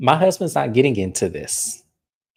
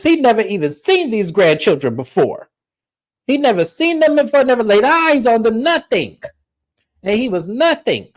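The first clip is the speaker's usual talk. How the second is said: higher, rising indignant scolding, half-played; mock scolding mom; 8 semitones higher than usual. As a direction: scold the daughter like an offended mother. Miss Susan scolding, retold.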